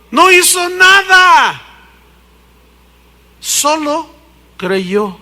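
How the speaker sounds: loud, high, slow No hizo nada; preaching shout. Real, not acted. Preacher to the congregation, a slow high shout. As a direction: hammer the answer home from the pulpit. Preacher answers his own question.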